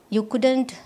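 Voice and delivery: ordinary delivery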